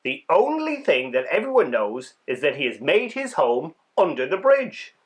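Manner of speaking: plain reading voice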